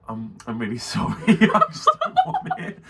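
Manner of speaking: apology breaking up into high laughter; laughing imitation. Retching friend apologises to shopkeeper, helpless laughter. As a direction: barely get the quote out for giggling.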